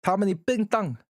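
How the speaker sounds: mock Chinese. Teasing chat with mock Chinese gibberish. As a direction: fake Chinese, sing-song and teasing. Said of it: "sound like you're just making fun of Chinese".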